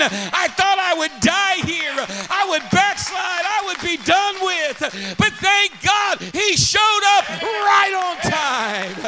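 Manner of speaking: high, loud revival shout; shouted testimony, drawn out at the peaks; full-force preaching. Real, not acted. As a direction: preach it out to the whole house.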